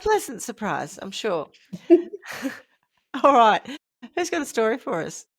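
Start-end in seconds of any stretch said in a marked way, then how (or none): 1.9-3.6 laughing as she starts. Real, not acted. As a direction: giggle through it. Laughing, then starting the story.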